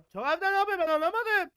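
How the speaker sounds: high, loud exasperated yelling; frustrated shout. Real, not acted. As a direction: shout it at the screen in disbelief. Crie contre le monstre.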